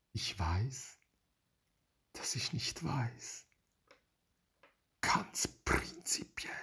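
slow, whispered afterthought; hushed. Real, not acted. Hushed close to a philosophical point.

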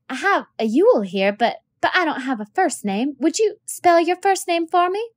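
teacher asking a dirty pupil to spell his name; flustered teacher. Acted. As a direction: coax the boy politely, a little flustered.

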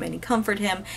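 usual speaking voice

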